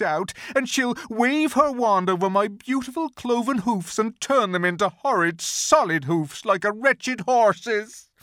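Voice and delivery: a terrified faun sobbing out his fate; crying faun. A narrator's reading. Faun telling Lucy of the witch's punishments, crying. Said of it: "beginning to cry again".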